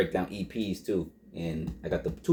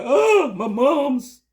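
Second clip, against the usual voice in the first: mock mother; 8 dB louder, much slower than usual. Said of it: "Mother's like".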